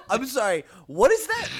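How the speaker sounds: high laughing what-is-that; laughing disbelief. Real, not acted. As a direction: crack up in disbelief. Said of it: "He's having a rough night".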